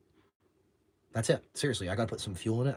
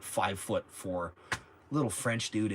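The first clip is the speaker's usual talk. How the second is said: ordinary talk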